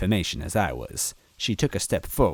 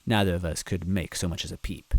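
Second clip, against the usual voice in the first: ordinary delivery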